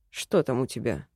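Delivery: low, soft man's voice; elf man voice. A narrator's reading. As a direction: ask low and curious. Elf character voice. (низкий мужской голос эльфа)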